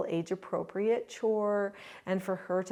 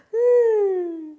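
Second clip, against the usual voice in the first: put-on high, loud whiny baby hum; long high "mm" like a pleading baby; toddler's wordless please; 13 semitones higher, 11 dB louder than usual. Toddler asking nicely for a cookie, high hum. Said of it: "And she would say".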